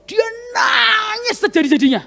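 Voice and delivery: retelling a sob in a cracked cry. Retold or imitated. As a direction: let the voice break into a cry. Woman sobbing at his feet.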